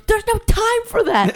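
high, laughing mock urgency; mock dramatic customer. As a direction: play him as a drama queen in a hurry. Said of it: "And he goes".

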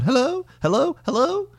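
high repeated automated hello; skipping robot. Retold or imitated. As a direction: imitate a stuck recording. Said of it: "and skipping, like, Max Headroom".

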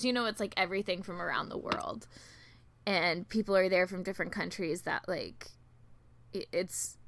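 usual speaking voice

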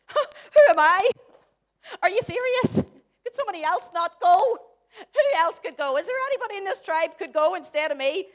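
loud, disbelieving protest; incredulous Moses. Acted. Acts Moses protesting God's call.

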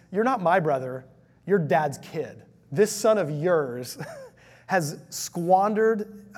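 loud, high, bitter outburst breaking into a laugh; mad brother. Acted. Elder son rails at father about his brother, loud bitter anger. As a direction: spit out this son of yours. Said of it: "really mad at you".

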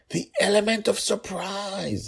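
high-voiced urgent father warning; stage whisper. A narrator's reading. Father warning daughter by the door, high stage whisper. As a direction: hiss the warning, pitched high. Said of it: "he whispered".

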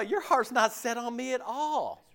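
usual speaking voice

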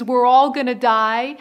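loud, frantic cry of doom; panicked yell. Retold or imitated. Panicking captain shouting to passengers in storm, loud cry. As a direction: yell it like everyone's about to drown. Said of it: "the captain comes out and screams".

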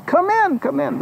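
high-pitched, hearty calling-in; welcoming host calling out; eager welcome. Acted. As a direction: call the guests in from the rain, high and eager. Host welcomes travelers at door.

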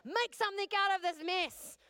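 high, loud demand. Retold or imitated. Woman repeating her demanding prayer, loud and high. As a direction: bark it at heaven as an order. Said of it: "So demanding, eh?".

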